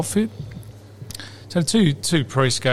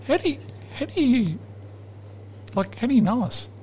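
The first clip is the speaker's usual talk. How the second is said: puzzled priests; 8 semitones higher, 7 dB quieter than usual. Retold or imitated. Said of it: "look at each other and go".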